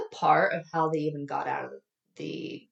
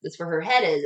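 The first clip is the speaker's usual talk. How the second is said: ordinary talk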